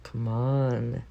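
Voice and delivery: normal talking voice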